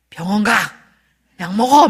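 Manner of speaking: short, forceful commands; mock brusque order. Husband answering wife's menopause complaint, with brusque orders. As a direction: imitate a blunt, loud husband.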